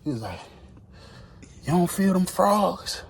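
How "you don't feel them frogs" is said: mock spaced-out voice. High man asks about frogs.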